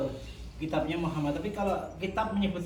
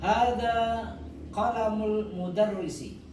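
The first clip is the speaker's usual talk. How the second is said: slow, raised model answer; 4 semitones higher, slower than usual. Shown as a demonstration. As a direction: stretch it out slowly. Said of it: "Saya baca jenengan anu ya". (jawaban contoh lambat)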